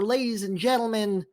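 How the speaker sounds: building hype, dropping to a hush; announcer countdown. Acted. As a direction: count it down like a showman. Caster's dramatic countdown.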